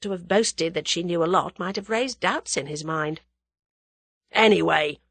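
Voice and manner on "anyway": louder, irritated crook; snarled. A narrator's reading. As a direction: snarl it with mild menace. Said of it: "snarled Whittington".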